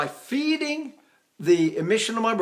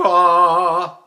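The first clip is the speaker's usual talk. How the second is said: louder ah with the breath attached; attached chest ah; 8 dB louder than usual. Shown as a demonstration. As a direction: anchor the ah on the diaphragm. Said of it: "there's my attachment".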